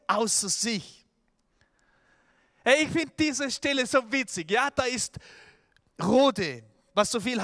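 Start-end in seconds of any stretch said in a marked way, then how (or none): none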